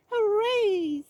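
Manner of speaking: high, loud hooray; excited cheer. A narrator's reading. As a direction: cheer with delight. Mouse gets the skates.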